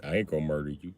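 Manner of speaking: put-on low, soft creepy kid voice. Son calmly reassuring his scared dad, low creepy murmur. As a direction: say it low and softly, eerily calm. Son's slow calm voice.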